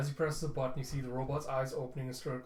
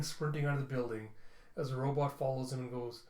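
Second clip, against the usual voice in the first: ordinary delivery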